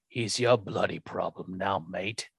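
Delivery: imitating a Cockney accent, low and soft; mock Cockney accent, trailing off quietly. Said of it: "in the very Cockney British accent".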